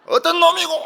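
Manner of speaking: mock Gyeongsang brawler, very high and loud; Gyeongsang dialect shout. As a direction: bellow a challenge in imitated Gyeongsang dialect. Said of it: "경상도 사람들 싸우는 거 보면 경상도 말로".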